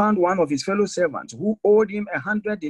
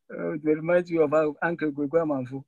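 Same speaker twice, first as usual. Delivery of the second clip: normal talking voice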